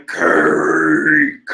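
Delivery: deep mock yell of cake; monster yell. Big Mom yelling for cake, a deep mock monster yell. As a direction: bellow it low like a hungry monster. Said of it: "Big Mom was yelling".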